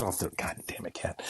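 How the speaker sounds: hushed whisper at the cat. Real, not acted. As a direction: hiss it under the breath. Scolding cat on his chest.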